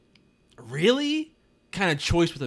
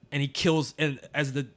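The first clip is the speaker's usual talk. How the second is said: usual speaking voice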